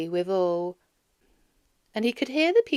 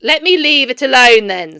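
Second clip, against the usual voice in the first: loud, curt dismissal; Scrooge grumbling; 4 semitones higher, 15 dB louder than usual. A narrator's reading. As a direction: brush him off. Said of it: "said Scrooge".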